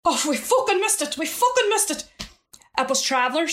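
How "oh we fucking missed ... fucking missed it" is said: higher, exasperated cursing; retold dismay. Travellers who missed the funeral, higher and exasperated. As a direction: play the men's frustrated lament, much higher. Men lament missing the funeral.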